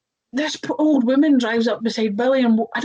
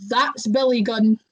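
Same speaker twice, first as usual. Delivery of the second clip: ordinary talk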